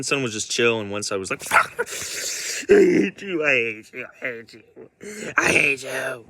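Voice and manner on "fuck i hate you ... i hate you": loud, hammed-up hateful outburst; mock hateful son. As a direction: imitate an angry son lashing out.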